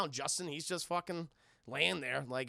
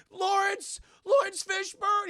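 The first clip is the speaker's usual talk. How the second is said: high, loud call for help; frightened shout for a crewmate; 13 semitones higher, 9 dB louder, slower than usual. Retold or imitated. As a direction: yell his name down the corridor. Man calls for help.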